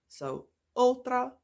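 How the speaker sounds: slow Afrikaans ultra; repeated slowly. Shown as a demonstration. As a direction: drill "ultra" again slowly. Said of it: "in the beginning of a word like".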